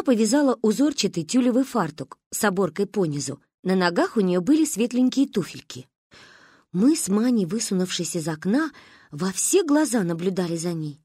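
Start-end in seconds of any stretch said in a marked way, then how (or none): none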